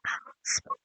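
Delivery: breathless whispered question; dazed, barely whispered. Retold or imitated. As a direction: whisper it to yourself in disbelief. Dazed patient repeats the word.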